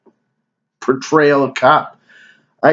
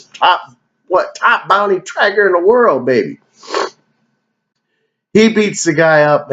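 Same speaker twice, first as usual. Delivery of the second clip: usual speaking voice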